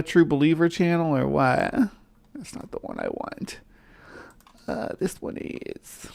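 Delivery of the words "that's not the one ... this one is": very quiet, near-whispered aside; muttering to self. Real, not acted. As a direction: murmur to yourself while hunting for the right video. Searching for the right video.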